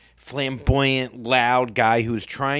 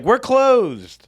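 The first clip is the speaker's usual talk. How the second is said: higher, louder, bright shop greeting; mock cheery greeting; 11 semitones higher, 5 dB louder than usual. Taco staff greeting customers, hammed-up cheery welcome. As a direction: sing it out like a counter worker. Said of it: "but it sounds like".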